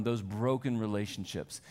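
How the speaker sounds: normal talking voice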